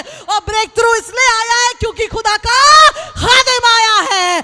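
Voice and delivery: loud, high, cracking preacher's proclamation; preaching at full force. Acted. Preacher announcing breakthrough to the congregation, fervent shout.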